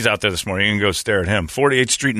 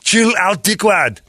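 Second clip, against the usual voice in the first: put-on higher, louder Arnold accent; 11 semitones higher, 3 dB louder, slower than usual. Customer answering the mask clerk in an Arnold accent. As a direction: brush him off like Arnold. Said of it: "in that accent".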